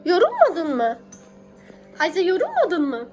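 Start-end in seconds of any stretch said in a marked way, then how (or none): none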